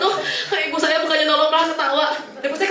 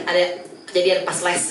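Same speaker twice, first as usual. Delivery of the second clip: ordinary talk, nothing marked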